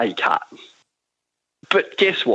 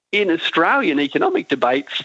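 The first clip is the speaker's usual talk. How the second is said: ordinary talk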